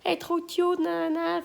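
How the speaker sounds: high, cooing fan voice; gushing fan comment. Retold or imitated. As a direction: gush like an adoring commenter. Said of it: "On dit".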